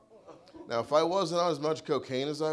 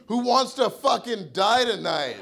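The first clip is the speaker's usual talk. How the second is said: high, loud enraged bellow, laughing; furious yelling; 10 semitones higher, 4 dB louder, slower than usual. Retold or imitated. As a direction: scream it like you want a fight. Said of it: "I just went".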